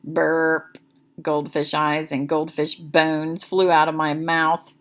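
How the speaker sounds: ordinary delivery, nothing marked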